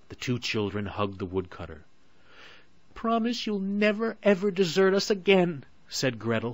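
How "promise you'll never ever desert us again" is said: high, louder, earnest plea; pleading girl. A narrator's reading. Gretel to father, hugging and pleading. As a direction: beg like a child hugging tight. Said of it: "throwing her arms round her father's neck".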